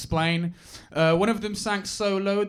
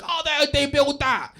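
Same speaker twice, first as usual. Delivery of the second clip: high, exclaiming mock dad voice; hammed-up amazed East London dad; construction-obsessed dad; 10 semitones higher, 4 dB louder than usual. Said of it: "he was obsessed with construction".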